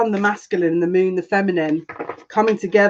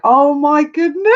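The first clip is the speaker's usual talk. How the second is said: excited gasp of delight; 8 semitones higher, 5 dB louder than usual. Real, not acted. Overjoyed at viewers connecting in chat.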